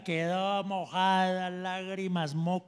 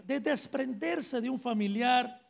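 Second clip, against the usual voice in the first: ordinary talk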